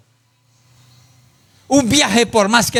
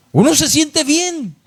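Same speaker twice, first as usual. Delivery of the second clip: much higher, ringing out the answer; emphatic affirmation; 9 semitones higher than usual. Real, not acted. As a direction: proclaim it to the congregation with conviction. Preacher answers his own question emphatically.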